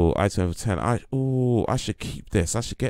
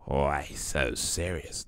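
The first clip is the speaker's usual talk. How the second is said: slow, softer attempt at a villain voice; Joker; 5 semitones lower, 8 dB quieter, much slower than usual. Streamer asked to do the Joker, slow Joker impression. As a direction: draw it out slowly and quietly, menacingly. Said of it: "do the Joker".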